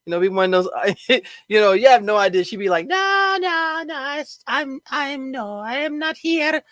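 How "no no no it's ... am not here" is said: high, put-on island accent, bashful denial; a hint of a Caribbean island accent, much higher; mock island accent. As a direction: imitate a shy island accent, badly. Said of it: "That's not her accent".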